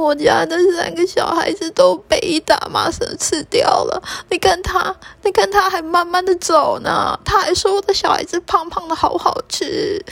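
high, tearful bird voice sobbing out the story; weeping as she tells the pigeon; crying mother swallow. Acted. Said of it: "燕子妈妈一直哭". (燕子妈妈高声哭诉)

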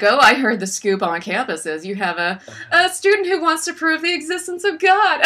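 high, loud condescending socialite; snobby dinner guest. Retold or imitated. Snobby guest mocking Mina, loud and high condescending. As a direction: sneer it like a snob at a party.

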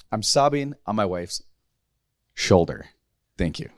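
real emotion breaking through, voice dropping low; holding back tears while recalling his dad; choked up. Real, not acted. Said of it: "Today's just one of those days for me".

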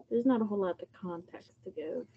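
normal talking voice

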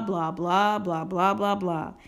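ordinary talk, nothing marked

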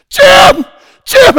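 high, loud calls across the store; wife screaming. Retold or imitated. Wife calling husband from the store: loud scream. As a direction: shout his name to get him over. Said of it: "my wife screams".

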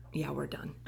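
flat whispered verdict; whispered deadpan. Retold or imitated. Girlfriend deciding the relationship is over, whispered deadpan. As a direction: breathe it out, done with him.

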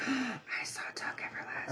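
gasp, then a soft excited whisper. Real, not acted. Fan gasps recognizing a film she loved, whispering.

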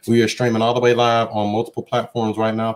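normal talking voice

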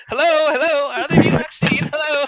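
high, loud hello calls; calling out. Retold or imitated. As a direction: call out to the horses. Vlad calling to the horses.

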